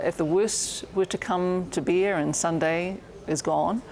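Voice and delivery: ordinary talk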